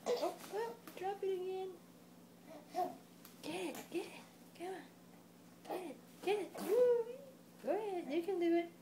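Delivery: high, bright encouragement; excited cheering. Real, not acted. Parent cheering baby to grab the toy, excited.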